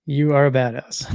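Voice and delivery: ordinary delivery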